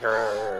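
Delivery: nasty growl. Retold or imitated. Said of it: "She's got a really nasty look on her face, like".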